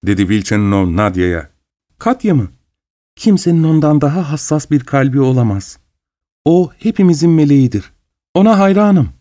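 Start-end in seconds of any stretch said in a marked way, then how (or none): 2.0-9.1 put-on bright, eager praise from a young girl; young girl full of enthusiasm; enthusiastic. A narrator's reading. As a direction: gush about Katya. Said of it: "dedi kız coşkuyla".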